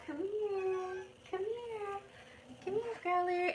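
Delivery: calling her cat in high kitty talk. Real, not acted. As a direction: coo up high to coax the kitty over. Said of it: "everybody talk kitty talk".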